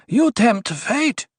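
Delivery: high, drawn-out rebuke; snapped warning. A narrator's reading. Duri warns the dwarf off, snapping. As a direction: scold slowly, pitched right up. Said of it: "Duri snapped".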